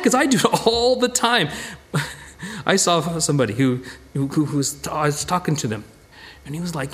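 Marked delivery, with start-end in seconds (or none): none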